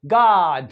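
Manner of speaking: गॉड as "gaad", long open unrounded AH; American open O. Shown as a demonstration. Teacher demonstrating American गॉड to students, long open AH. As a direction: open the vowel of गॉड like the first vowel of father. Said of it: "थोड़ा सा longer".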